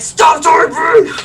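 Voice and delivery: mock parental shout, stretched out; yell. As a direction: yell it like telling off your own kid. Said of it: "just yell at and be like".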